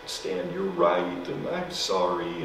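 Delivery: a mild hint of crying, slowly; tearful apology. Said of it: "she cried".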